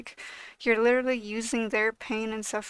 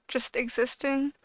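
ordinary delivery

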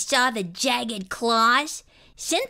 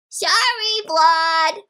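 loud, higher-pitched call; 4 semitones higher, 5 dB louder, slower than usual. A narrator's reading. Child apologising to departing monster, called out loudly. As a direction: call after someone leaving. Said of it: "I called".

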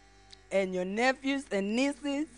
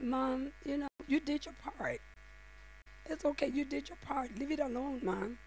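softer, gentle reassurance to mom; gentle reassuring son; 5 semitones higher, 11 dB quieter than usual. Retold or imitated. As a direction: soothe her quietly, like a caring son. Son soothing his complaining mom.

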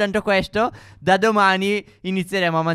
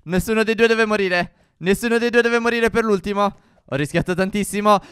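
ordinary delivery

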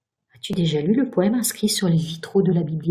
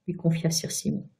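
usual speaking voice

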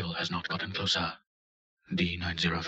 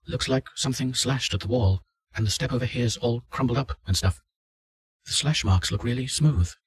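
louder, higher-pitched character voice; lightly voiced test subject, speaking up; test subject reporting; 5 semitones higher, 7 dB louder than usual. Subject tells the doctor what he sees on the stairs, lightly voiced test subject.

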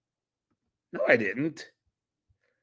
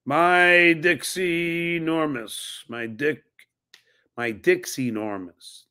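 "My Dixie Normus" read very slowly, word by word, a touch higher; sounding out a name; 4 semitones higher, slower than usual. Shown as a demonstration. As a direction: puzzle out "My Dixie Normus" syllable by syllable. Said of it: "Doesn't really make sense".

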